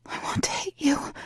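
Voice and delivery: high, breathy whisper. A narrator's reading. Heroine whispering a challenge to her lover. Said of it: "I whisper".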